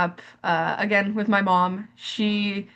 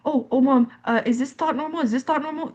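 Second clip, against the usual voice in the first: seeking reassurance; 6 semitones higher than usual. Acted. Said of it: "I was asking for reassurance".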